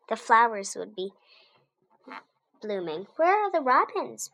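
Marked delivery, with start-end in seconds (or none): 3.2-4.3 put-on higher, eager child's question; wondering aloud. A narrator's reading. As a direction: ask it brightly like a curious child. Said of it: "Rosie wondered".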